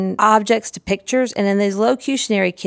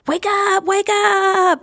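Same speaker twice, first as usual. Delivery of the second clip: much higher, bright and bouncy wake up; big exciting wake up; 14 semitones higher, much slower than usual. Shown as a demonstration. Waking the toy person for the child, much higher and excited. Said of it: "my louder wake up voice now".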